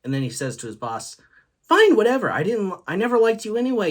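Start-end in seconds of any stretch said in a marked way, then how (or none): 1.6-3.9 high, loud huffy comeback; mock angry retort. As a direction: fire back in acted indignation. Grandfather's angry retort to boss.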